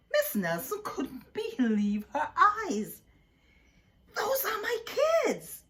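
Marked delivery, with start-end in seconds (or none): 4.1-5.6 high, loud scratchy cry; shocked and scratchy. A narrator's reading. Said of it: "she said in a scratchy voice".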